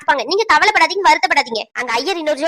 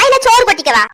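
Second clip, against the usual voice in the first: louder, mocking jab with a falling end; rude jab; 5 semitones higher, 4 dB louder than usual. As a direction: sneer "இல்ல சோறு கொட்டிக்கவா" as an insult. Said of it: "இல்ல சோறு சாப்பிட போனாங்களோன்னு கேக்கேன்".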